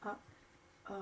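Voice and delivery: breathless whispered worry. Acted. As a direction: whisper it to the kids. Crowd freezes at sneeze.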